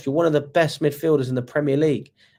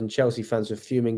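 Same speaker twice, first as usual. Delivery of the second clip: ordinary talk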